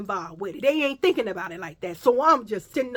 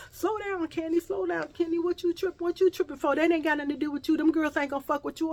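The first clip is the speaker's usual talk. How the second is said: pleading friend; 7 semitones higher, faster than usual. Retold or imitated. Friend dismissing her worry.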